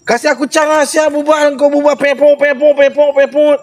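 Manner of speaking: high, needling singsong jeer; mock him in a high teasing voice; mocking taunt. Real, not acted. Taunting his friend on a live stream with a high mocking jeer.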